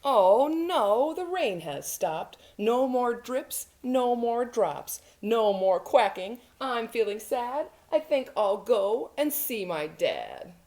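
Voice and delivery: mildly dismayed storybook little duck; disappointed duckling. Acted. Duckling telling children the rain has stopped, mildly disappointed. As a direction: lament it lightly, with an exclaimed start.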